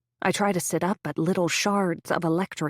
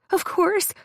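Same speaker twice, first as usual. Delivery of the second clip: high, bright compliance; high sweet friend; 11 semitones higher than usual. A narrator's reading. Friend agreeing sweetly, high. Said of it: "Mary Elizabeth says".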